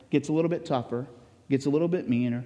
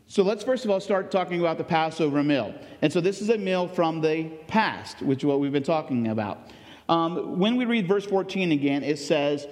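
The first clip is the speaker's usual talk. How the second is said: ordinary talk, nothing marked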